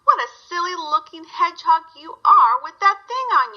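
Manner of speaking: lightly voiced barn cat teasing. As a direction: call down to tease the hedgehog. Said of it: "he called down".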